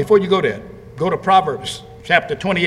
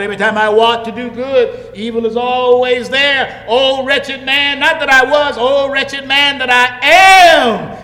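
lamenting Paul; 8 semitones higher, 8 dB louder than usual. Acted. Preacher voicing Paul's lament.

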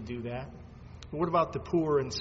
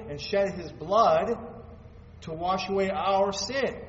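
louder, rising preacher's call; preaching at full force; 3 semitones higher, 6 dB louder than usual. Acted. Pastor to congregation about the cross, preaching loudly. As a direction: drive the gospel home with growing force.

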